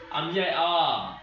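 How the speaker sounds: आ stretched slowly to show a long vowel; slow demo of a long vowel; drawn-out long vowel. Shown as a demonstration. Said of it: "कौन कौन सा है देख लीजिए".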